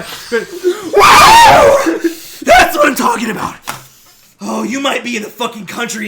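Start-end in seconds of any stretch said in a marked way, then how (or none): 0.0-3.6 high loud whooping cheer; wild cheer. Acted. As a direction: whoop it up like a party animal. Partying cheer.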